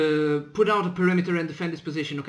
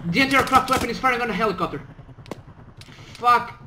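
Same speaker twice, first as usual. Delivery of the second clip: raised urgent alarm; alarmed shout; 6 semitones higher than usual. Acted. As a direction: call it out in alarm. Alarm as helicopter fired on.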